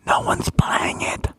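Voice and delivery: hushed conspiratorial whisper. Real, not acted. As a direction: lean in and whisper it like a secret. Confiding aside to co-host.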